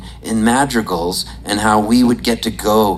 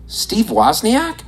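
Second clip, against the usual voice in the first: higher, slow, rising question; stunned recognition; 8 semitones higher, slower than usual. Retold or imitated. Comedian recognizing his idol, stunned. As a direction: ask the name in slow, high disbelief.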